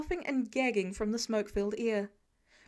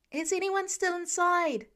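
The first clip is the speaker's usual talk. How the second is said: hollering; 6 semitones higher than usual. A narrator's reading. Peter calling out to the crowd at the fire, hollering. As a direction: call out over the commotion. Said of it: "he hollers".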